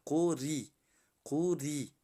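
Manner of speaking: قوری with a light, thin ر before the i. Shown as a demonstration.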